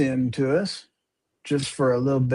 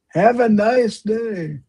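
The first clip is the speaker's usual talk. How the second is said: mock sweet; 7 semitones higher, slower than usual. Shown as a demonstration.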